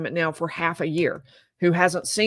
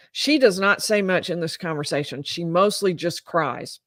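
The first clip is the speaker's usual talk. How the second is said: ordinary talk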